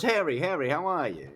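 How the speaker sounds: high, loud acted greeting; calling for attention. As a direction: hail an old friend up the sidewalk. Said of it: "trying to get his attention".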